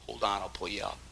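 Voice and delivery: soft, low reassurance; reassuring. Acted. Friend reaching out to a fallen man, softly. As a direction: gently offer a hand. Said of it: "Brother Howes be saying".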